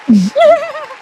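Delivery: very high squealing laugh. Real, not acted. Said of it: "I'm crying".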